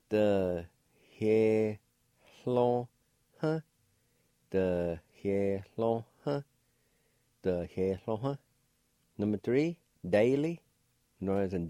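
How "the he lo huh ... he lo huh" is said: "The, he, lo, huh" with a faint h on each syllable; syllable-by-syllable demo. Shown as a demonstration. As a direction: model each syllable "he, lo, huh" separately for the learner. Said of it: "It'll sound like this".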